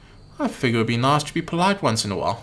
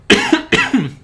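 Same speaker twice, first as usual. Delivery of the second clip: sharp cough; 13 semitones higher, 7 dB louder than usual. Acted. Cough in the dream scene.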